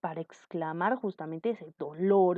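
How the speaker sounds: usual speaking voice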